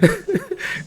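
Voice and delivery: high-pitched laugh. Real, not acted. Laughing along at own remark.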